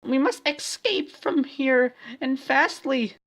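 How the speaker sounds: very high, loud urgent call; cried out. Acted. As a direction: shout it in a squeaky panic. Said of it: "Twilighty cried out".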